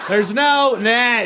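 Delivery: high, slow, laughing yell again held long; laughing high shout; repeated gleeful shout. Retold or imitated. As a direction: push the shout further, laughing.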